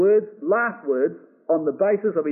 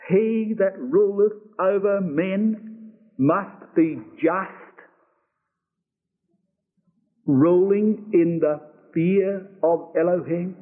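very slow, word by word, with pauses before "be just"; weighty emphasis; much slower than usual. Shown as a demonstration. Preacher asks what he said, then answers very slowly and emphatically. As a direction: spell out the verse slowly, each word weighted, held open at the end. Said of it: "with all the words of emphasis".

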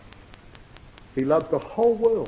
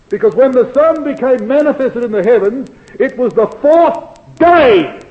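loud high pulpit emphasis; preaching at full force; 8 semitones higher, 10 dB louder than usual. Acted. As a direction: hammer the point home. Emphatic climax of argument.

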